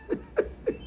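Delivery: brief amused laugh; short high laugh. Real, not acted. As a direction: let a quick laugh slip out. Laugh after Cain's retort.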